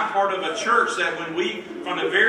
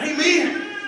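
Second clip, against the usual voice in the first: high, exclaimed amen; re-enacted burst of approval; excited amen; 12 semitones higher, 5 dB louder than usual. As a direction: cry amen with delight. Retells exclaiming amen at picture.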